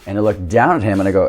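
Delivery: normal talking voice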